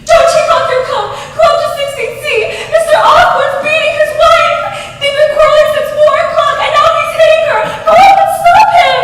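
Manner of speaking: high, loud, fast panicked scream; wife screaming at her husband for help; frantic scream. Acted. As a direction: scream at him to hurry upstairs. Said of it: "she screamed".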